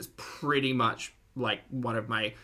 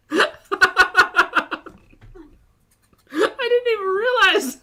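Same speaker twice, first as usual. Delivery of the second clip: high, breaking with laughter; cracking up mid-sentence; laughing hard; 10 dB louder, slower than usual. Real, not acted. Laughing hard at mukbang clip.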